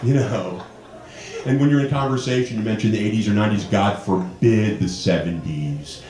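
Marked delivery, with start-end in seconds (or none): none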